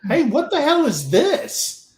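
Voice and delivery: a mock-outraged challenge to the boss; retold indignant outburst. Confronting his sergeant major over the promotion list, high indignant outburst.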